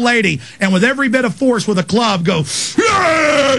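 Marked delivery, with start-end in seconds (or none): 2.8-3.6 sudden high grunting yell of a swinging cop; imitating a cop's brutal war cry; mock battle yell. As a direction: yell like you're swinging a club. Host acts out police club blow.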